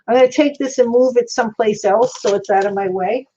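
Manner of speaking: usual speaking voice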